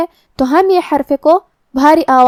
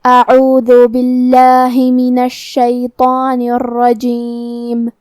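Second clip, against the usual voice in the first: very slow, drawn-out melodic tajweed; Quran recitation; 2 semitones lower, much slower than usual. Shown as a demonstration. Teacher reciting the verse for students, slow melodic tajweed. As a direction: chant each word long and measured.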